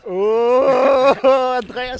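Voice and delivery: loud, high, laughing cry of delight; excited shout. Real, not acted. Vlogger cheers friend Andreas after his winning run, excited laughing shout.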